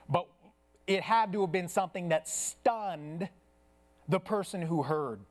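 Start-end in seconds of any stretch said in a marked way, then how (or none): none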